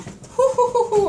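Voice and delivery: quick string of high whews; high hooting breaths from burning eyes; repeated high whews. Real, not acted. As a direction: puff out whew after whew at the sting.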